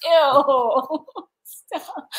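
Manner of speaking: high squealed ew and stop; mock disgust. Real, not acted. Wife squealing at husband's joke, high mock disgust. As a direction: squeal at him to quit teasing.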